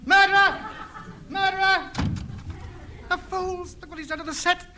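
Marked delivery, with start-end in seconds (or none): 0.0-1.9 high, loud outraged cry; accusing shout. Acted. Radio ham shouting after the man who wrecked his set, outraged cry. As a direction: hurl the accusation after them.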